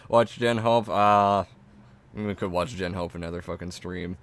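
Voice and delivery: usual speaking voice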